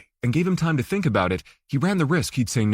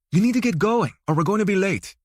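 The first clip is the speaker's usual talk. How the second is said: higher, urgent wife's voice; impatient wife; 6 semitones higher than usual. A narrator's reading. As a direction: push him out the door. Wife urging husband.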